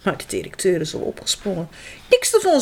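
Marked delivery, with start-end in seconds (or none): 2.1-2.6 high firm refusal. A narrator's reading. Director refuses Egbert, firm and raised. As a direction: put your foot down. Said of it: "zegt hij ferm".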